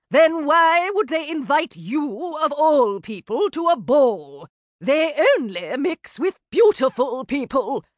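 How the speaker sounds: lightly voiced disdainful aristocratic lady; a hint of a snobbish matron, scornful; sniffy sister-in-law. A narrator's reading. Sister-in-law scorning Georgie's invitation, lightly voiced disdain.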